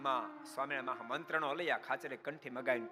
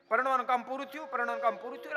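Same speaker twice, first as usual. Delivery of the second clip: higher, fast, impatient repeated question; eager impatient asking; 7 semitones higher, faster than usual. Retold or imitated. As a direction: press the priest, eager to finish. Groom keeps asking the priest. (ઉતાવળથી ઊંચા અવાજે વારંવાર પૂછવું)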